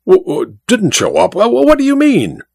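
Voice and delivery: stuttering, rising to a very high what; shocked, stuttering question; stammering shock. A narrator's reading. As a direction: stammer in bewilderment.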